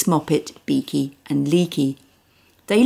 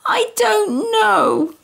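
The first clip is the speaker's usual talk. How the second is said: put-on tearful child; much slower than usual.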